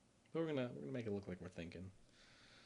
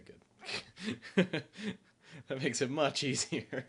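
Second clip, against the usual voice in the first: giggly laughing; 7 semitones higher, 9 dB louder, much slower than usual. Real, not acted. Tipsy laughing at the cards.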